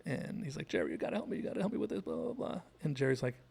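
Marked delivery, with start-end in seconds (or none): 0.7-2.6 panicked plea, climbing much higher; George. Retold or imitated. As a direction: mock-desperate begging for help. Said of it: "George calls Jerry when he's in trouble".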